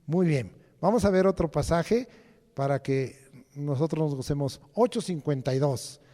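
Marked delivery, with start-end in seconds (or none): none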